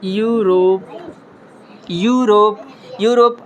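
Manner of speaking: यूरोप said with a clear y-glide start; sounding out Europe. Shown as a demonstration. Teacher modelling Europe with a y sound. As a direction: repeat यूरोप carefully three times. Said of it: "exception देखेंगे".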